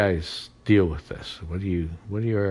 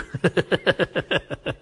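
short high laugh; amused laugh; 12 semitones higher than usual. Real, not acted. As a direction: laugh at the joke. Laughs at own joke.

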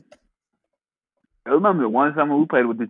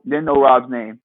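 ordinary talk, nothing marked

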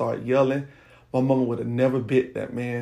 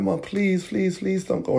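higher-pitched retold begging; retold tearful pleading; 7 semitones higher than usual. Child begging mom not to leave, retold tearful pleading. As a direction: plead like a scared child, without real sobbing. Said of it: "And I was crying".

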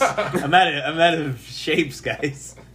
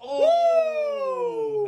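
surprised shriek. Real, not acted. Sudden shriek in chat.

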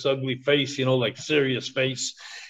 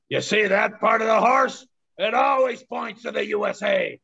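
higher, half-shouted stern lawman; shouted Mountie punchline; 6 semitones higher than usual. Retold or imitated. Mountie lifts the horse's tail for the tourist, shouting it out. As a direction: bark the punchline like a rugged Mountie.

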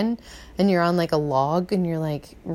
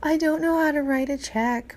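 helpless complainer; 8 semitones higher than usual. Retold or imitated. Imitating people who can't manage bills, helpless high whine.

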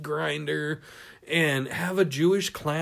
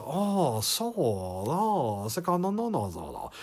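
put-on singsong caricatured Chinese accent, swooping up and down; mock Chinese accent; 2 semitones lower, 4 dB quieter, slower than usual. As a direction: imitate an actor's hammy fake Chinese accent. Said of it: "portrayed a Chinese character, and he does".

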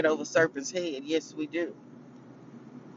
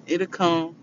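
ordinary talk

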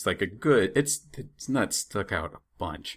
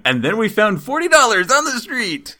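mock excited; 8 dB louder than usual. Jokey wish, high and loud.

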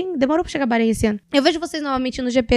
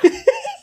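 nervous shriek laugh. Real, not acted. Laughing in panic.

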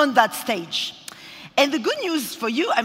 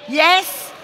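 loud audience prompt; 5 semitones higher, 6 dB louder than usual. Real, not acted.

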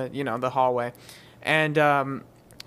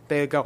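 gasp. Acted. Guards gasp.

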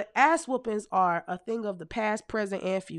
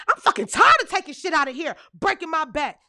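exasperated mom; 7 semitones higher, 5 dB louder than usual. Retold or imitated. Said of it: "she was like".

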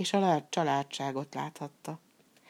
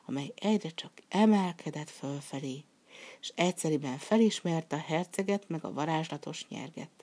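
plain reading voice

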